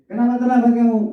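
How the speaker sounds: high-pitched accusing demand; confrontational, going straight in. Shown as a demonstration. Teacher jumping on a late pupil, accusing. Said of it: "Pertama langsung ngegas dulu ya".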